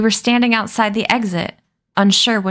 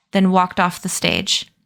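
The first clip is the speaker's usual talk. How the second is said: ordinary delivery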